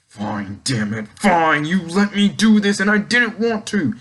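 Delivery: hammed-up angry giving in; mock heated villain. Orochi giving in to rage, mock heated outburst. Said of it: "gets super heated, and he's like".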